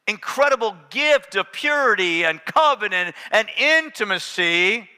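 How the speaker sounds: booming sermon delivery; full-force preaching. Acted. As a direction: preach it out to the whole church.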